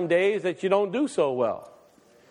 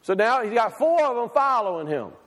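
ordinary talk

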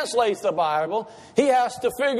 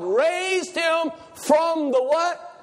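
preacher's call; 6 semitones higher, much slower than usual. Real, not acted. Preacher prompting congregation answer.

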